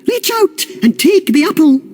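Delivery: high, sugary coaxing; sweetly coaxing queen. Acted. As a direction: lure him in sweetly, up high. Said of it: "Finella smiled sweetly and said".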